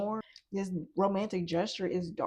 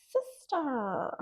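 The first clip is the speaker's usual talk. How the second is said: light, teasing call; playful sing-song; 4 semitones higher than usual. Acted. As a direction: call her sister, teasing. Playful repeated address.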